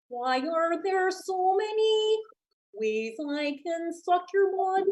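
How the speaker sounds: slow, soft puppet-frog voice; Kermit vampire. Acted. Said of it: "Kermit the Frog if he were a vampire".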